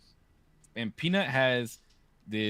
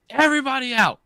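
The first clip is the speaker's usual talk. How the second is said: firm order; 14 semitones higher, 8 dB louder than usual. Retold or imitated. Dad ordering kids out.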